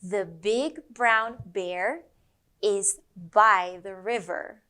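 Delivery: "The big brown bear is by the river" slow with each B popped; slow teaching sentence. Shown as a demonstration. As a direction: say it slowly, popping every B. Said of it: "One more time".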